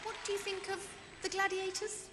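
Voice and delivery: ordinary talk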